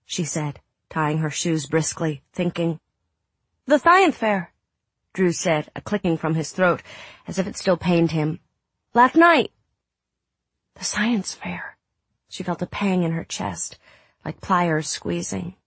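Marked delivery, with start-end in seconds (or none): 3.7-4.5 put-on high small boy voice; a sick little boy; young boy voice. Child Drew voiced high. 8.9-9.5 put-on high small boy voice; a sick little boy; young boy voice. Child Drew voiced high. 10.7-11.8 hoarse slow whisper; sick boy whispering, slow. Acted. Drew repeats, whispered.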